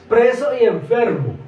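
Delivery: loud preaching punch; loud emphatic summary. Real, not acted. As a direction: drive the point home.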